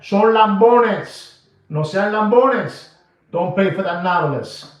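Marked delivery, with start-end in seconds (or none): 0.0-2.9 louder, slow scolding in Spanish. Real, not acted. Regañando fuerte y lento. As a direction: call them out, drawing it out.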